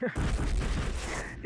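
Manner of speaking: soft laugh after joking; low, airy chuckle. Real, not acted. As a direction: laugh quietly at your own joke. Laughs after his joke scream.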